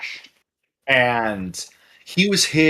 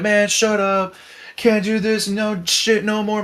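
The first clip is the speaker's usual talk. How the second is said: higher-pitched mock complaining; imitated protest; 8 semitones higher than usual. Drunk guy to counter girls, high mock griping. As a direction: act out a drunk customer fed up.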